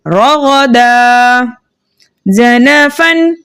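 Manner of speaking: slow chanted words with drawn-out endings; pausal-form recitation. Shown as a demonstration. Teacher shows pupils how to read when stopping, slow chanted demo. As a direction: chant each word slowly, stretching the last sound. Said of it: "हालत वक्फ में हम कैसे पढ़ेंगे?".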